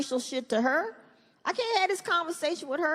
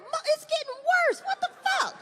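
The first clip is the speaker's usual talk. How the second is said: much higher, exasperated; 14 semitones higher than usual. Retold or imitated. Dad fed up with the nickname, high. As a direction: whine it in disbelief. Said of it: "I'm like".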